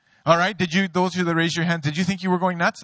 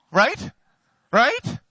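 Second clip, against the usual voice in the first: ordinary talk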